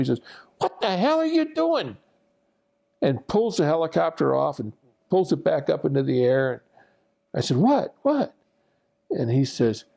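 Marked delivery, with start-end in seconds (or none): 0.6-2.0 imitated screaming. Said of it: "starts screaming at me". 7.6-8.3 high, startled yelps in retelling. Retold or imitated. Startled trainee being screamed at.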